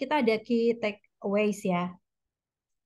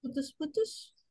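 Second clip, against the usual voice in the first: faint, breaking up over the call; cutting in and out, barely heard; barely audible; 5 semitones higher, 9 dB quieter, slower than usual. Real, not acted. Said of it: "Nggak kedengeran".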